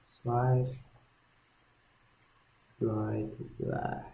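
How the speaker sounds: slowed right down, soft, with long pauses. Shown as a demonstration. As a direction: draw out THAT softly, pausing long after SLIDE. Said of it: "Let me do it one more time slower".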